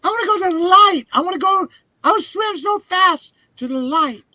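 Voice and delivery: strained high-pitched shouting, words stretched out; high frantic yell. Acted. Drowning character swimming up to the light, high frantic yell. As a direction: cry out desperately in a very high voice.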